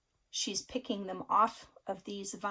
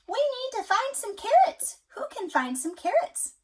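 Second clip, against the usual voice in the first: very high, louder hen asking; little red hen; 13 semitones higher, 8 dB louder than usual. A narrator's reading. Parent reading to child, hen asks for helpers. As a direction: call out like an eager little hen. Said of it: "asked the little red hen".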